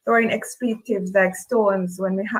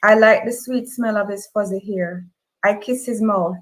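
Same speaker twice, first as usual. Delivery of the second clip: ordinary delivery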